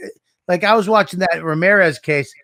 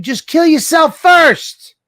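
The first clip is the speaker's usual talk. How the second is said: louder, higher, slow exasperation; exasperated outburst; 11 semitones higher, 6 dB louder, slower than usual. Real, not acted. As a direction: throw up your hands and blurt it.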